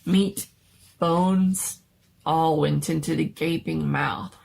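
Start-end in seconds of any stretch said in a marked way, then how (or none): none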